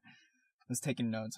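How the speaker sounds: ordinary delivery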